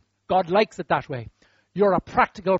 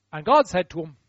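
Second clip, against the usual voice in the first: ordinary talk, nothing marked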